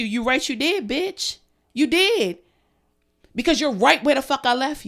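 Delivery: loud, scornful jab; angry retort. Real, not acted. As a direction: spit it back at them. Angry rant at ex.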